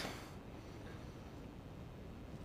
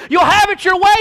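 preacher's shout; 6 semitones higher, 3 dB louder than usual. Acted. Preacher calling out congregation.

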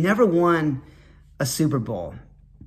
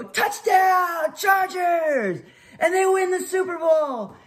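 high, drawn-out touchdown call; announcer; 12 semitones higher, slower than usual. Retold or imitated. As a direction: proclaim the championship win to the stadium. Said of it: "I would turn into the announcer again".

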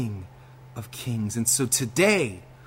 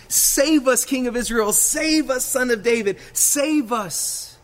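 desperate crowd plea; 9 semitones higher, slower than usual. Acted. Preacher voicing the crowd's plea, high desperate cries. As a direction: beg like a crowd crying for rescue. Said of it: "this crowd saying".

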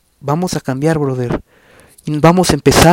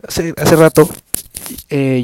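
usual speaking voice

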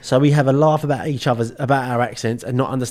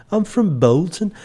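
put-on raised, drawn-out guess at Bolton accent; 6 semitones higher than usual. Unsure how it sounds, mock Bolton accent. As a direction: attempt a Bolton accent, slow and lilting. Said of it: "what a Bolton accent sounds like".

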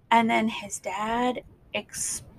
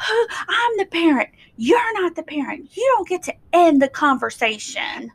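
angry father; 7 semitones higher than usual. Retold or imitated. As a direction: lay down the law like a controlling parent. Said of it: "he's like".

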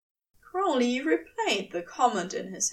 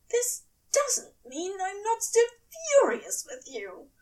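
hissed through teeth; 9 semitones higher than usual. A narrator's reading. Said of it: "hissed through clenched teeth".

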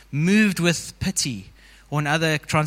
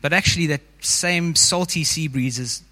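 guided meditation. Acted. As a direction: ease the congregation into the scene.

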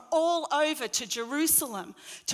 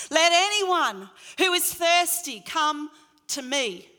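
loud voice; 4 semitones higher, 7 dB louder than usual. Retold or imitated. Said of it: "he calls out in a loud voice".

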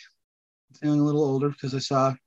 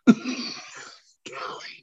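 breathy giggle through the name; laughing whisper; 8 dB quieter than usual. Real, not acted. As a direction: whisper it while cracking up. Playful reveal of a surprise name.

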